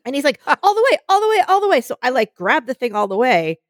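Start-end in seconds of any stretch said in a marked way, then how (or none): 0.6-1.8 high, urgent repeated call; imitated shouting. Sailor yells rudder orders to a novice, mock urgent. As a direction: mimic a sailor yelling instructions. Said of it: "he's shouting all these instructions".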